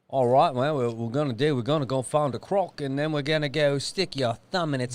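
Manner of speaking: broad croc-hunter Australian accent. Shown as a demonstration. Dad showing his kids, Australian accent. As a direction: put on a playful Australian accent. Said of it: "can you do, um, Australian?".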